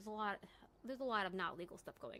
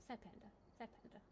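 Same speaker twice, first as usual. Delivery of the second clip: much softer mock pout; mock sad; 12 dB quieter than usual. Real, not acted. Reacting that the friend isn't streaming, quiet mock sadness. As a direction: sulk it softly, playfully.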